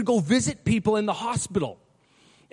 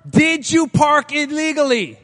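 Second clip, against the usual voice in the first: exasperated judge; 4 semitones higher, 7 dB louder, slower than usual. Retold or imitated.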